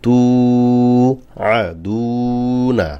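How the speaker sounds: "তু" and "দু" held long, "না" short; one-alif stretch. Shown as a demonstration. Teacher demonstrating madd to learners, long "তু" and "দু", short "না". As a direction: draw out both u vowels in "তু আদু না". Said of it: "এক আলিফ টান দিতে হবে".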